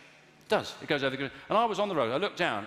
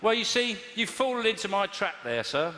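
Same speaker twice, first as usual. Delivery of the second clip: mock triumph; 6 semitones higher than usual.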